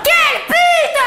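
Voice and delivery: high, loud, laughing insult; mock outrage. Real, not acted. Comedian explodes at her mother, high and laughing. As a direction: shriek it out in fake fury.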